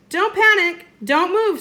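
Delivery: high, loud urgent warning. Acted. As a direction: warn her sharply to freeze. Urgent warning near dinosaur.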